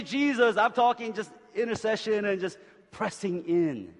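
loud, high, driving sermon voice; fired-up pulpit preaching; full-force preaching. Acted. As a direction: preach it out to the whole room.

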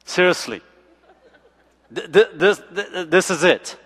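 stammering, laughing letdown; laughing disbelief. Retold or imitated. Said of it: "And I was like".

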